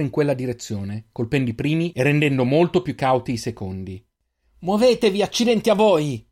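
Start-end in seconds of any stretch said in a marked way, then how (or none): 4.6-6.3 high, loud urgent yell; girl yelling at the two strays to run; shouting. A narrator's reading. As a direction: shout to get them moving now. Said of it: "urlò la ragazza".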